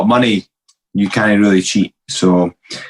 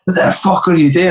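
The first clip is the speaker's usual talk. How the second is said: higher pitched yell at the thief; retold yell; 7 semitones higher than usual. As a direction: yell it at a burglar caught in the act. Confronting the car thief.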